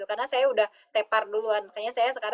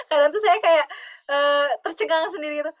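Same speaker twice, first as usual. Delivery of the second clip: laughing; 4 semitones higher, 4 dB louder than usual. Real, not acted. Recalls being amazed, laughing.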